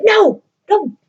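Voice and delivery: high, loud panicky no; mock squeal of alarm; alarmed refusal. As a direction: stop her in a hurry. Cyrano stopping Roxanne in alarm.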